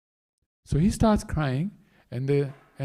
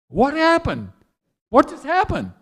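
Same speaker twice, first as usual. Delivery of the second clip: high, loud, baffled question; stunned bewilderment; 9 semitones higher, 5 dB louder than usual. Acted. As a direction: exclaim it as if utterly thrown. Stunned flute after Krishna jumps inside.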